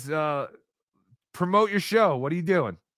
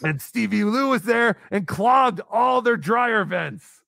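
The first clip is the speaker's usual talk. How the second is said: slow, high hammed-up shouting; mock shout; 7 semitones higher, 4 dB louder, slower than usual. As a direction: bellow it out like a big announcement. Reading out a silly donation message.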